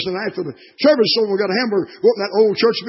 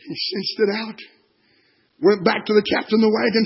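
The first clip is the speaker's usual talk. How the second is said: normal talking voice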